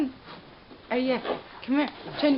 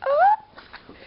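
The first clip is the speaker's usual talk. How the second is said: much higher, louder delighted squeak; high squeal; 8 dB louder than usual. Real, not acted. Gushing over the dog.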